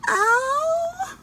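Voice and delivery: high, loud, drawn-out sigh of a mother; a dismayed mother calling her child; exasperated mama. A narrator's reading. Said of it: "said Mama".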